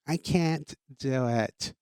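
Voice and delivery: slow, sagging mock whine of a whiny baby; drawn-out whine. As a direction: stretch out the can't in a slow, sinking mock whine. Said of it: "you sound like whiny babies".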